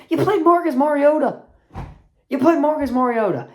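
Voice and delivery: loud, high, scornful emphasis on played; mocking disbelief at a weak opponent; exasperated rant. Real, not acted. Ranting at Chiefs fans.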